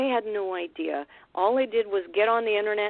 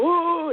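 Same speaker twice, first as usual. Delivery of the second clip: a short high, louder hoot like a mountain gorilla; gorilla call imitation; 11 semitones higher, 5 dB louder than usual. Retelling the recording, she mimics a mountain gorilla's high hoot. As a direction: hoot it out like a gorilla before chest-pounding. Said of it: "like when they go".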